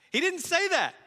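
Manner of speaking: high, loud insistence; emphatic denial. Real, not acted. Pastor rejecting the imagined casual Jesus, loud and high. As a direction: slam the point home.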